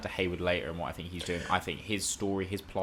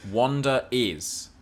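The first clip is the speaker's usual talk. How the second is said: slow, louder, higher, drawn out for effect; dramatic reveal; 5 semitones higher, 6 dB louder, much slower than usual. Acted. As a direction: build it up like a big announcement. Dramatic reveal of Scarlet Witch.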